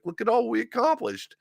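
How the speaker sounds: very high squeaky boast; hammed-up high-pitched brag; mock proud player. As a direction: falsetto teammate showing off the win. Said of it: "being like".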